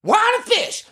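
high excited shout; excited cry. Pretend. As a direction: yell it with glee. Jesus hawking wine and fish.

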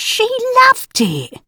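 high, drawn-out insistence; defiant insistence. Acted. Mum insisting daughter enjoyed it, defiant. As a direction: stretch it out slowly, pitched right up.

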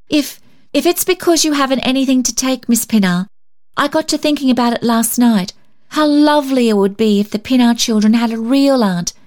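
put-on high girlish plea; eager child. A narrator's reading.

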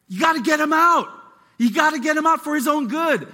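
loud, high, urgent rebuke; Paul calling out leaders. Retold or imitated. Paul shouting at church leaders to remove him. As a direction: preach it at the church leaders like a fired-up apostle. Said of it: "He's like".

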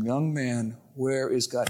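a lightly voiced rabbi, low and hushed; a hushed, ominous final ask. Rabbi asking the boy a third time, low and hushed. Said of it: "he said a third time".

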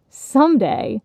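high, scornful echo; incredulous, falling jeer; mocking laugh. A narrator's reading. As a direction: throw the word back derisively. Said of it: "nineteen seventies nostalgia laughed".

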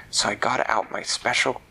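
ordinary delivery

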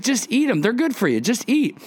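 high mock mom voice, urging; coaxing mom. Mom urging her kid to eat liver, high-pitched coaxing. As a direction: imitate a mom pushing food, pitched well up.

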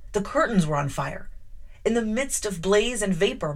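ordinary delivery

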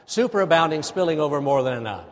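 quick, loud run-through of the phrases. Shown as a demonstration. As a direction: rattle it off in one go. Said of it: "Now let's say it faster".

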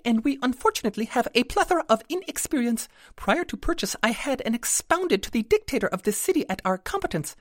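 very high fairy voice, rapid and apologetic; a tiny owner owning up, a bit embarrassed; sheepish pixie. A narrator's reading. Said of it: "sheepish grin on her face".

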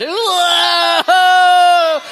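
loud, high shout of delight at his own joke; excited shout. Real, not acted. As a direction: yell it out, hyped up. Preacher shouts his own fire pun.

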